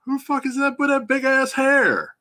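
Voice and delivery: high, startled mock outburst; shocked exclamation. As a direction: retell the double take, pitch jumping up in disbelief. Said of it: "and I was like".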